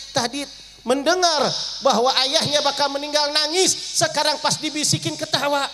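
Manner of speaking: high preacher voice telling of tears then laughter; preaching at full force. Acted. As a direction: preach the contrast with rising fervour. Preacher high fervent narration.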